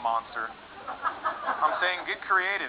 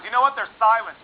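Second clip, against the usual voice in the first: snotty retort; 6 semitones higher, 5 dB louder than usual. Acted. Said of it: "She goes".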